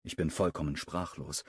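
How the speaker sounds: ordinary delivery, nothing marked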